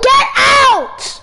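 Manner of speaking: loud, exasperated shout at a sibling; forceful order to leave; real yelling. Real, not acted. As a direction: yell it, totally fed up. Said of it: "Because you're yelling".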